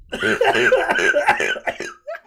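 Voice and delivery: high coughing laugh, choking; laugh-cough after inhaling wrong; coughing on smoke. Real, not acted. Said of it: "The smoke went down the wrong pipe".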